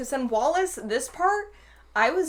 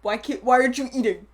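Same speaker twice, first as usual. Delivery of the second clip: louder, mildly indignant demand; mock mad; 5 dB louder, faster than usual. Retelling Howard scolding Wallace at dinner, mock mad. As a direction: put on a bit of exasperation. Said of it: "He's, like, mad".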